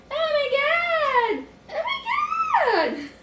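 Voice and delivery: very high, drawn-out squealing; overjoyed squeal. Real, not acted. Speaker thrilled by happiness within, high elated squeal.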